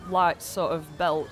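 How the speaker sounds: normal talking voice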